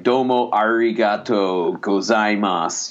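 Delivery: slow, over-pronounced American take on "Domo arigato gozaimasu" with hard English R; American tourist saying "arigato" with a strong rolled-out R, slowly; "Domo arigato gozaimasu" in a heavy American accent, slow and over-clear; American accent Japanese. Shown as a demonstration. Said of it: "an American accent to say that".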